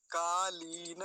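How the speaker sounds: कालीन said slowly with a long lee and voiced final na. Shown as a demonstration. Teacher explaining the long vowel, drawn-out ee. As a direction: hold the ee in कालीन, then add na. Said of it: "लकार पर दीर्घ ईकार है".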